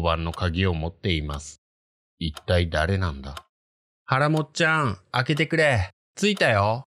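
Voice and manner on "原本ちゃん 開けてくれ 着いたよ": high, coaxing voice outside the door; eerie stranger's voice. Retold or imitated. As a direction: call sweetly through the door, unsettling. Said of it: "と続きます".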